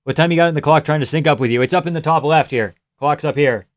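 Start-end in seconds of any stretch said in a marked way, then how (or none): none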